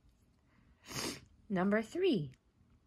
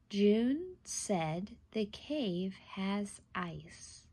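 slow dictation; much slower than usual. Shown as a demonstration. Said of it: "I will say a sentence and you will write".

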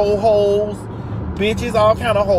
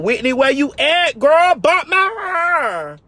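calling across to Whitney, high and drawn out; 7 semitones higher, slower than usual. Real, not acted. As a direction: holler for Whitney, stretching out bump my hair. Calling out to friend for bump.

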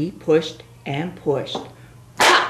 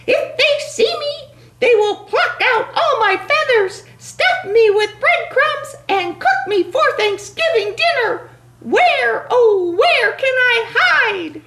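panicky turkey voice; 3 dB louder than usual. Acted.

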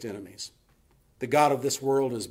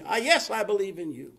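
high-pitched eager agreement; desperate cliff man; 9 semitones higher than usual. Retold or imitated. Cliff man assuring God he believes, high and desperate. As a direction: imitate a man agreeing in panic.

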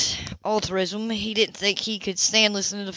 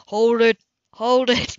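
louder, urgent cut-in; hold on; 5 dB louder, slower than usual. Acted. Cam cutting off Philip's shopping list, loud. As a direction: stop him mid-list, louder.

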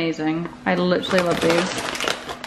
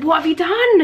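mock shocked outburst; 9 semitones higher, 5 dB louder than usual. Partner reacts to the brows.

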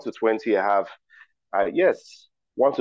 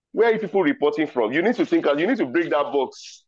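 mock exasperation; 6 semitones higher than usual. Quiz host to players who said duck, teasingly exasperated. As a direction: chide the crowd playfully. Host scolds wrong answers.

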